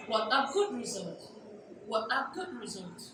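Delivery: soft exclamation "What a good result", falling at the end; quietly exclaim, pitch dropping on "result". Shown as a demonstration. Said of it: "we use our falling tone for exclamation".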